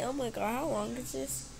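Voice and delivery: usual speaking voice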